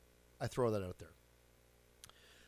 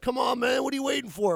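high, loud teasing call; hammed-up kids shouting; mock kids calling out; 11 semitones higher, 10 dB louder than usual. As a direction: yell up at the hesitating dad.

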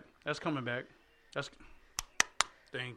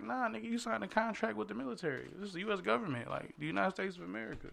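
refusing; 7 semitones higher than usual. Retold or imitated.

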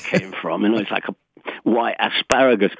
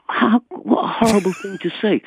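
higher-pitched mock horror; retold shock; 10 semitones higher, 3 dB louder than usual. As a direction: act out your appalled reply. Retold shocked reaction to remark.

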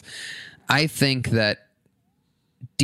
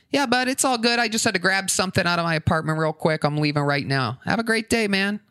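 forced positivity; 6 semitones higher than usual. Retold or imitated. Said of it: "still pushing the positivity".

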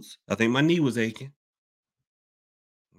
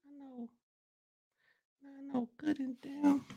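tiny, high-pitched strangled fuming; stuck-mad; 13 semitones higher, 13 dB quieter than usual. Acted. Host mimicking someone too mad to move, in a high, hushed fury. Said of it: "get so mad you can't even move".

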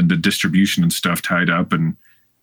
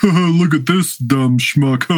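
mocking voice; 5 semitones higher, slower than usual. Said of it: "being like".

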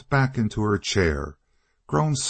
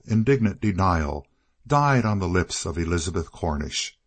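ordinary delivery

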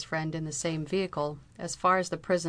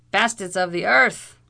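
much louder furious roar; 3 semitones higher, 10 dB louder than usual. A narrator's reading. Captain roars curses at the bailiffs. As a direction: hurl the curse at them. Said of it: "roared O'Blunderbuss".

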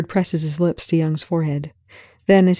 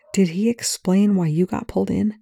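plain reading voice

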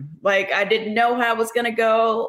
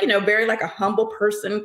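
ordinary talk

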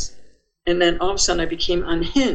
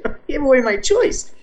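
startled realization; 8 semitones higher than usual. Retold or imitated.

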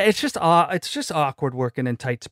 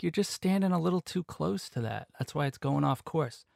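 quiet instructor voice; 3 semitones higher, 8 dB quieter than usual. Retold or imitated. Retold instructor correcting him quietly.

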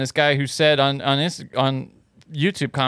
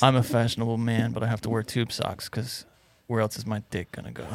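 soft, deadpan macho voice; manly man; 3 semitones lower, 7 dB quieter than usual. Pretend. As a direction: confide quietly as the tough guy. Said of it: "the guy that you're doing right now".

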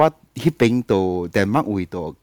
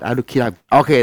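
usual speaking voice

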